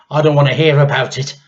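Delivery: put-on a boy drowning her out; shouting boy. A narrator's reading.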